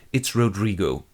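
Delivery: normal talking voice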